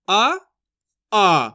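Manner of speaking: आ drawn out, low and loud; long stressed aa. Shown as a demonstration. Teacher contrasting the short vowel, pulling आ long and loud. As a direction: pull the आ long with force. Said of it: "खींच के पढ़ देंगे आ, जोर लगाएंगे".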